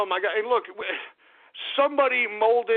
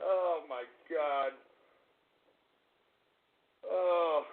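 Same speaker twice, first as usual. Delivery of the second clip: low, soft, slow dismay; despairing groan; 8 semitones lower, 8 dB quieter, much slower than usual. Real, not acted. Host despairing over his ruined show, low groan. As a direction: sink into it, worn out.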